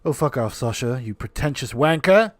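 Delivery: bad English accent, loud on wanker; rough English accent, sneering. Telling Sasha off in a bad English accent. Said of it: "I don't have a good English accent".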